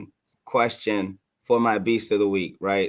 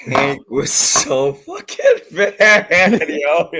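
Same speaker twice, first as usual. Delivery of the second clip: louder and higher, breaking into laughter; can't get it out for laughing; laughing hard; 6 semitones higher, 7 dB louder, slower than usual. Real, not acted. Cracking up to cohosts over Hank's clip, laughing hard.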